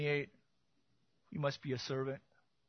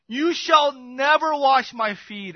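proud refusal; 7 semitones higher, 8 dB louder, slower than usual. Acted. Peter's proud refusal acted.

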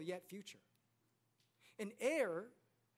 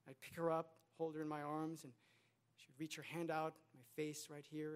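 usual speaking voice

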